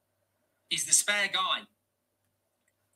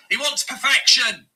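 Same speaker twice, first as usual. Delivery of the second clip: louder, punching the word wants; exasperated loud jab at the manager; loud emphatic; 4 semitones higher, 9 dB louder than usual. Real, not acted. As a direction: hammer home he wants perfection. Fan mocking demanding manager.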